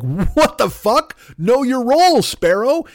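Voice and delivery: high, drawn-out indignant yell; mock outrage. Homeowner to a sparrow in the house, mock outrage.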